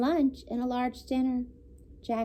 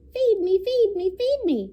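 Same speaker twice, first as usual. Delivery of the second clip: much higher, louder begging; hungry duckling; 10 semitones higher, 5 dB louder than usual. A narrator's reading. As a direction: demand food like a baby duck. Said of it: "cried the hungry baby duck".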